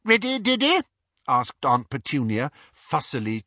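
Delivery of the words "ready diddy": fussy mother. A narrator's reading. Mother fussing over son.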